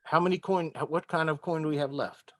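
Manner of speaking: normal talking voice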